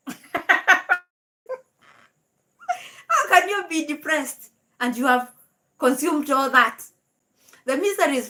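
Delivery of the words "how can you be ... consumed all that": laughing disbelief, higher and louder. Real, not acted. Laughing at Rocio mom's complaint, laughing disbelief. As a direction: laugh through the question in disbelief.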